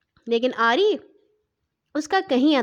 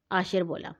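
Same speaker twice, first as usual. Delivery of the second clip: usual speaking voice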